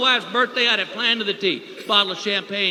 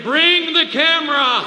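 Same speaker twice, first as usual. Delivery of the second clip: drawn-out, high holler; slow, raised mock yell; comic scream; 5 semitones higher, 4 dB louder, slower than usual. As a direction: holler it proudly through the house. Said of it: "And you're screaming".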